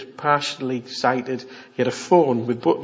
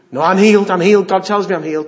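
loud, higher protest; mock insistence; 4 semitones higher, 8 dB louder, faster than usual. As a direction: insist stubbornly, imitating a believer. Mimics insistent person claiming healing.

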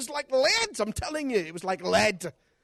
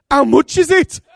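higher, much louder shocked cry; 9 semitones higher, 16 dB louder than usual. Retold or imitated. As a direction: blurt it out in shock, loud and high. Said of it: "I was so shocked".

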